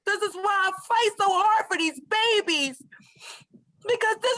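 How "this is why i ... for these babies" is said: usual speaking voice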